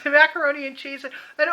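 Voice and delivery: normal talking voice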